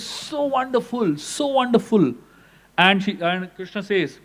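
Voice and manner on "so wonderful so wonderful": high, slow and delighted, lingering on wonderful. Retold or imitated. Radharani to Krishna, overjoyed, high and slow. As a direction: savor it like a joyful devotee. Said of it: "Radharani becomes so happy".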